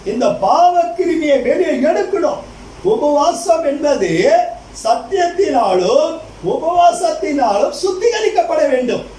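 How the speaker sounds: high, slow, emphatic sermon voice; measured pulpit proclamation; preaching. Acted. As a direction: draw out each key word to the congregation. Preaches slowly with raised pitch.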